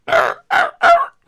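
loud, very high imitation bark; embarrassed dog bark. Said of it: "bark like you're embarrassed".

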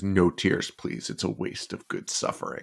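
hushed, near-whispered Pinhead line; Pinhead quote. Retold or imitated. Imitating Pinhead telling crying Kirsty, hushed whisper. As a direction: drop to a cold whisper. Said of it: "and he says".

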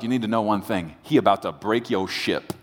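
ordinary delivery

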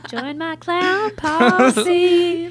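slow, high goofy clown voice; silly clown. Pretend.

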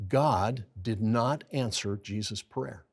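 ordinary talk

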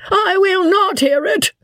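very high, squealing refusal; panicked squeak. A narrator's reading. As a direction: squeak it out at the top of your range. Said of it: "squeaked out Joss at the top of his voice".

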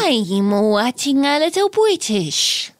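slow, exaggerated British drawl; put-on British voice, stretched out; mock British accent. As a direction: draw out a silly British accent. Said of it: "making fun of the sound".

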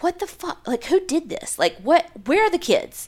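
high, alarmed mock questioning; high-pitched disbelief, lightly acted; retold bewilderment. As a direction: act out a wife's bewildered questions, pitched well up. Retold wife finding burning doll.

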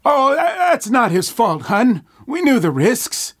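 put-on high, loud old man voice. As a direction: soothe her like an old husband. Gerald answers in old voice.

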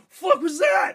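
shout. Retold or imitated. Soldier hit from tree.

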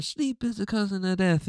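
high, softened sampled-refrain delivery. Retold or imitated. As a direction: imitate a rap sample, pitched high and falling. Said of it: "has him going".

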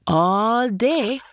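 plain reading voice

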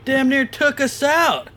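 high-pitched, shaken-up exclamation; startled indignation. Acted. Soldier reacting to a car nearly hitting them, startled. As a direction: exclaim it just after a near miss.

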